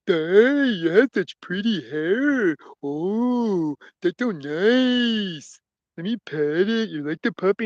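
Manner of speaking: slow, cooing oaf voice; admiring simpleton. Acted. Simpleton to farmer's little daughter, dopey and admiring. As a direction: stretch the words out in dopey wonder.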